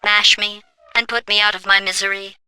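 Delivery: faint, drained, barely above a murmur; weak potato voice. Acted. Trapped in a potato, quietly mocking the test subject. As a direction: speak like a battery running out, quiet and spent.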